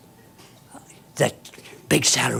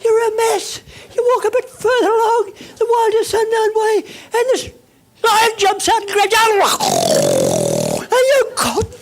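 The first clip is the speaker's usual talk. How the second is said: hammed-up panic, much higher and louder, half laughing; frantic story; 7 dB louder than usual. Speaker acting out the dreamer's disasters, frantic and shrill. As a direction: tell the disaster like a comic catastrophe.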